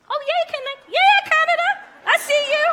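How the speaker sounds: high, loud cheer to the crowd; excited cheer. Real, not acted. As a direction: shout happily to the Canadians in the audience. Greets Canadians in the crowd.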